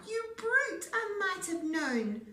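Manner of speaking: high, loud piggy cry; an indignant little pig; pig cries. A narrator's reading. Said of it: "Pig cried".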